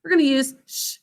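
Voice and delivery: ordinary delivery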